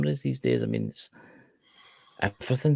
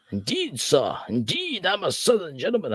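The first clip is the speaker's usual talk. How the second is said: put-on Southern gentleman accent; 8 semitones higher than usual. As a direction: play a pompous Southern gentleman. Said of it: "you sound like a slave owner".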